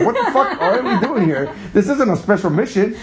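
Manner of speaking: high, laughing exclamation; amused outrage while retelling; laughing disbelief. Retold or imitated. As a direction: laugh through the baffled question. Retold baffled reaction, laughing.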